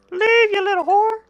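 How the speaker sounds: very high-pitched silly squeal; mock screech. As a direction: shoo it away in falsetto. Banishes a game creature.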